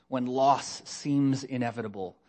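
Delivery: ordinary talk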